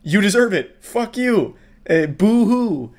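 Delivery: hammed-up dismissive heckle; mock angry crowd. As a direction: jeer at her as the annoyed crowd. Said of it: "people get mad at her like".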